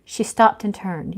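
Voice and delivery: ordinary delivery, nothing marked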